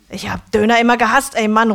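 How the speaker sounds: loud angry outburst; calling out. A narrator's reading. Döner man ranting at his customer, loud call. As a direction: rant it out loud at the room. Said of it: "ruft er".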